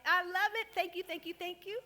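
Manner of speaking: high, loud, thrilled thanks; delighted cheering. Real, not acted. Speaker thanking a lively audience, delighted cheer. Audience responds loudly to her.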